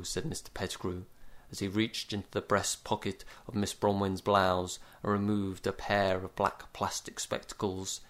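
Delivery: ordinary delivery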